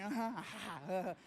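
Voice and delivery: jaded, half-hearted mock laugh; barely laughing. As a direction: imitate a weak courtesy laugh. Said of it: "she's barely laughing".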